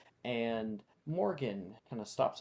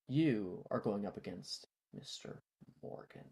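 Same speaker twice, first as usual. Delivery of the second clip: ordinary delivery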